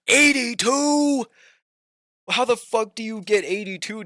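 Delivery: drawn-out incredulous yell; shocked. Real, not acted. Shocked at friend's score.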